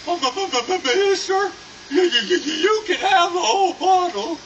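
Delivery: high, stuttering panic; terrified stammer. Acted. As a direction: stutter in fright like a quaking bartender. Terrified, shaking bartender stammers.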